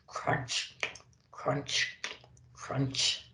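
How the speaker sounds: soft, breathy crunch sounds. A narrator's reading. Reindeer crunching the carrot, soft imitated crunch. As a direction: mimic munching a carrot.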